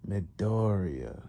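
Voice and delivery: very slow, drawn-out name; musing villain. Retold or imitated. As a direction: stretch "Midoriya" out, pondering it. Master muses on the name.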